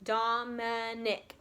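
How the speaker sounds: "Dominic" in three slow beats; split into syllables. Shown as a demonstration. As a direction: sound out dom, i, nic in "Dominic". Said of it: "has how many syllables".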